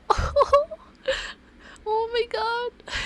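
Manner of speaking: very high squeals of delight; swooning squeal. Real, not acted. Player reads about imagined kissing, high swooning squeal. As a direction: squeal as if the romance is too much.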